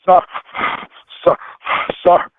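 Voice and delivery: gasping between single words, imitating a winded guard; out of breath. Winded security guard catching up to the driver, gasping. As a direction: act winded, puffing after each word. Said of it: "he's breathing hard and heavy".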